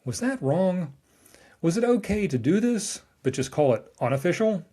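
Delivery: a hint of mock-innocent asking; George Costanza. Host paraphrasing a sitcom character, lightly acted mock innocence. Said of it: "To paraphrase George Costanza".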